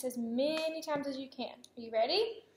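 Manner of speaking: ordinary talk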